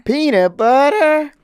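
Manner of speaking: high, loud, drawn-out disbelief; loud, soaring incredulous question. Real, not acted. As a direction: stretch the question out in amazement. Amazed at the peanut butter addition.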